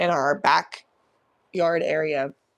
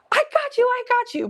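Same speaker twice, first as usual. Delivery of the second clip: reassuring; 5 dB louder than usual. Retold or imitated. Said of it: "and she goes".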